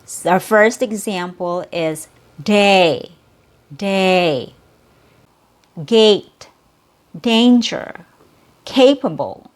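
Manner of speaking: each word said slowly with a long A; careful teacher word list; long A word drills. Shown as a demonstration. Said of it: "drop the jaw and then bring it back up".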